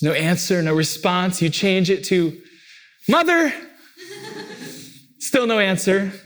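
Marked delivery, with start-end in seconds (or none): 3.1-3.5 put-on high, loud child's call across the house; calling mommy. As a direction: call out for mom like a kid. Child calling mom more formally.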